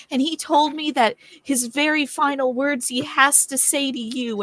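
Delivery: breathless, urgent bad news; a touch of hammed-up panic, slowed at the end; frantic messenger. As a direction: gasp out the tragic news to Billy. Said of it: "I'm like".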